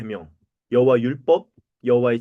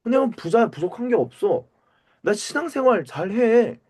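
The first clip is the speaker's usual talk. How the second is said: smug rich man; 8 semitones higher than usual. Pretend. Preacher voices a smug rich believer.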